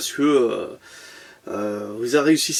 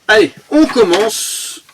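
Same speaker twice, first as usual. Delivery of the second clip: higher, slow, drawn-out "Allez, on commence"; hyped kickoff; 6 semitones higher, 5 dB louder, slower than usual. Acted. Kicking off the unboxing for viewers, hyped and stretched. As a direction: stretch "on commence" like a show host.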